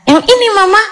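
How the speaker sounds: put-on high-pitched little child voice; child answering Mama. Acted child answering mother. (suara anak kecil menjawab mama)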